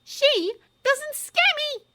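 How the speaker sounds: put-on high, slow, defiant girl's voice. A narrator's reading. Hermione defying Umbridge, high and slow. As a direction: spit it out, daring anyone to argue. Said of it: "said Hermione defiantly".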